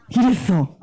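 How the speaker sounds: normal talking voice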